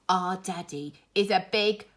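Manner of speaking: loud boastful cub; rude wolf cub. Acted. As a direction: brag at the teacher.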